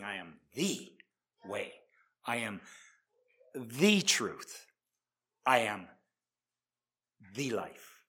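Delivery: "I am the way." said slowly, with "the" weighted; "the" emphatic, one slow deliberate line; stress on THE. Shown as a demonstration. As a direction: lean slowly on "the" so it means the only way. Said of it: "He's saying".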